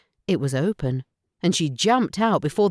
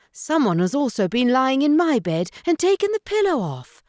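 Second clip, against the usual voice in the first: middle, motherly bear voice, higher; mama bear, a bit higher; 10 semitones higher, faster than usual. A narrator's reading. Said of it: "in her middle voice".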